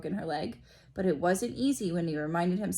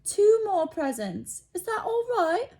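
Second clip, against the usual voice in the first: high, louder, sugary pleading; doting mother; 13 semitones higher, 5 dB louder than usual. A narrator's reading. Aunt Petunia placating Dudley, high sugary coaxing. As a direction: hurry to placate the child. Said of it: "she said quickly".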